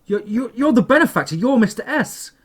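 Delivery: high, loud, stammering realisation; frightened stammer. A narrator's reading. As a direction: stutter out the accusation in fear. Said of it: "I stammered".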